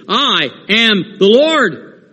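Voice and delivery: firm and ringing, slow on I am the Lord; rising to a high cry on the mountains line; emphatic. Acted. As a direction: declare it like the Lord who means business. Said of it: "our Father lets us know emphatically".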